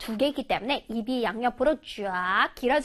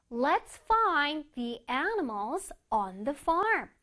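slow model reading; 3 dB quieter, much slower than usual. Shown as a demonstration. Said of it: "teacher models sentence".

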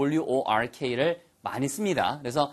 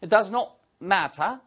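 higher British English, crisp t in "matter"; British "matter" with no final r; British accent; 5 semitones higher, much slower than usual. Shown as a demonstration. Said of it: "똑같이 영국식 발음이잖아요". (영국식 영어 발음)

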